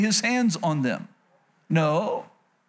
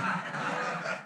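quiet breathy chuckle to himself; soft breathy laugh; 10 dB quieter than usual. Real, not acted. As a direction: laugh under the breath at his own joke. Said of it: "That's, that's funny right there".

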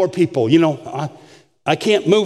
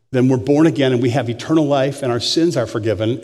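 ordinary delivery

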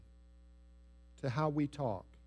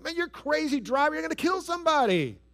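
high, loud road-rage yell; exasperated, high-pitched shouting; angry driver outburst; 12 semitones higher, 5 dB louder than usual. Acted. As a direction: yell at a driver who cut you off. Acted outburst at bad driver.

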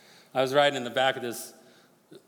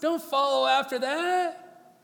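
higher, slow tempting voice of the flesh; 10 semitones higher, 3 dB louder, slower than usual. Acted. Preacher voicing his flesh urging grudges, slow and high. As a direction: play the flesh egging him on, slow and high. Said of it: "my flesh is sitting there going".

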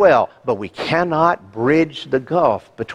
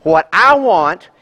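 loud, high, defiant selfish voice; mock defiant child demanding its way; selfish attitude; 5 semitones higher, 4 dB louder than usual. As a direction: play a stubborn "me first" heart. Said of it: "this attitude says".

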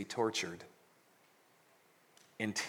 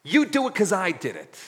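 much higher, louder bold demand; mock prayer daring God to forgive in return; challenging God; 8 semitones higher, 9 dB louder than usual. Shown as a demonstration. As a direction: say it like a dare to God. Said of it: "pray it that way".

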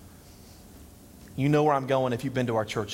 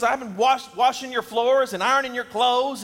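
high, loud list of chores; wife defending herself to husband, high and exasperated; indignant wife; 8 semitones higher, 4 dB louder than usual. Retold or imitated. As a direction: rattle it off in a higher voice, put out. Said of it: "She goes".

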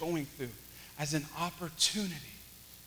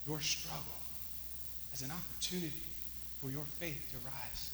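hushed preaching; 2 semitones lower, 15 dB quieter than usual. Real, not acted. Preacher praying for struggling congregation, hushed preaching. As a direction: bring it down to a prayerful hush. Hushed sermon close, prayerful.

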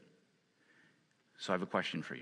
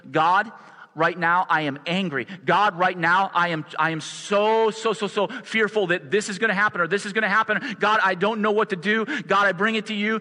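raised, higher, urgent cry to God; pleading anxious prayer; 6 semitones higher, 4 dB louder than usual. Retold or imitated. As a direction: pour out fear to God, pleading loud. Pastor voices his own anxious prayer.

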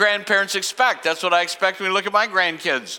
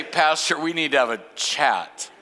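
lower, dry voice telling the pastor to come down a peg; a lightly voiced skeptical listener; mocking congregation member; 6 semitones lower than usual. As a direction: tease the pastor gently. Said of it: "How many of you would say".

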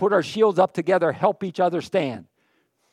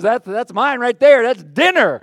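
higher, louder gloating predator voice; hungry lion; 6 semitones higher, 9 dB louder than usual. Acted. Lion spots the lone wanderer, gloating and loud. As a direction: claim the stray like a hungry lion. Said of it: "He says".

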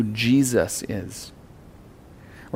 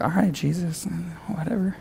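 dismissive mutter; 6 semitones higher, 8 dB quieter than usual. Retold or imitated. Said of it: "They were probably like".

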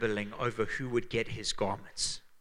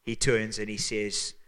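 usual speaking voice

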